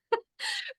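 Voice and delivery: high, soft burst of laughter; laughing. Real, not acted. Host recalling her ungraceful escape from the cage, laughing. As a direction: laugh at your own clumsiness.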